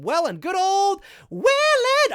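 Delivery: high, slow, booming cheer; drawn-out fond hurrah; nostalgic cheer. Real, not acted. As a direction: sing out the town's name fondly. Fond exclamation about hometown.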